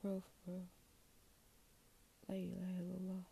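whispered sincerity; soft whisper. Real, not acted. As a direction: murmur it as a private vow. After pause, whispered.

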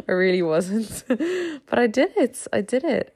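giggling through the admission; laughing. Real, not acted. Host admitting she doubted herself, laughing. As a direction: laugh it off, a bit sheepish.

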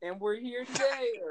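high stiff robotic YouTuber intro; robotic vlogger greeting, slow. Pretend. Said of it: "I'm Robotic Logan Paul".

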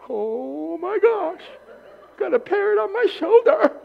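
high, slow, thrilled squeal of delight; giddy tourist. Pretend. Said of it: "If I did it, I'd probably do the same thing".

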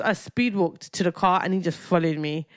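ordinary talk

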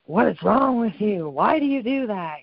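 imitating a fed-up parent snapping at a child; harsh scolding parent. Mother imitating a parent scolding her kid, mock harsh.

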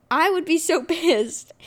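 a real laugh breaking in. Real, not acted. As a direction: laugh through the word, amused at her own anger. Laughs while recalling anger.

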